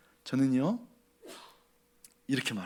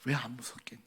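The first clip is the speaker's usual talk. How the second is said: tender reassurance; 6 semitones lower, 5 dB quieter than usual. Acted. God reassures Joseph.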